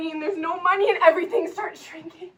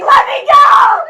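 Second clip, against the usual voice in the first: sobbing shouts, much higher and louder; screaming, crying; 8 semitones higher, 14 dB louder than usual. Acted. Girl screaming at the men dragging her away, crying. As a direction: scream and sob to be let go.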